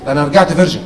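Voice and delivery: usual speaking voice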